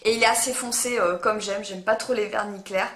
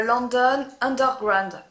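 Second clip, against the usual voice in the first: French speaker's careful attempt at English; slow, laboured English accent on a foreign name; self-mocked English accent; slower than usual. Real, not acted. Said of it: "avec mon super accent".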